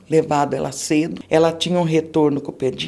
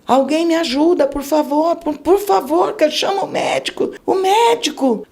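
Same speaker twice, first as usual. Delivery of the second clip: high, frantic cry for help; begging for someone to help the baby, much higher; retold desperate screaming; 10 semitones higher than usual. Mother screaming for help in the ER, frantic and high. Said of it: "comecei a gritar".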